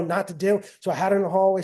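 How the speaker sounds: ordinary delivery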